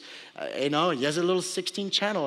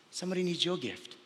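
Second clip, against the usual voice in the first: markedly softer, falling at the end; hushed repetition; 7 dB quieter than usual. Real, not acted. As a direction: drop the volume to drive it home. Last, hushed repetition for effect.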